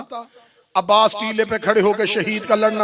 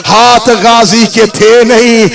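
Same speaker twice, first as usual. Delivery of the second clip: loud, chanted majlis recital; full-force preaching; 11 dB louder than usual. Acted. Preacher driving mourners to matam, loud chanting. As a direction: push the lament to its peak.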